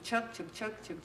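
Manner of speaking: train chugging, fading to near silence; fading chug chug. Pretend. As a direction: let the chugs die away like a distant train. Train sound imitation fading.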